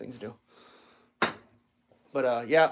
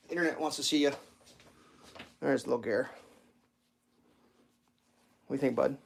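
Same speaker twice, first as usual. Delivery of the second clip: ordinary delivery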